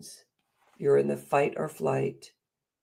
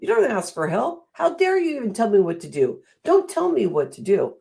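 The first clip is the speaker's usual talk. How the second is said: imitating a scolding elder; 8 semitones higher than usual. Mimicking the bossy voices she grew up with, scolding. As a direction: voice the stern parent who said it. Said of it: "I heard that many times for myself".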